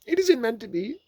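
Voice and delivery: very high, loud put-on voice; disguised Rabbit voice. A narrator's reading. Rabbit hiding who he is, disguised voice. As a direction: deny it in a false high voice. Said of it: "different sort of voice this time".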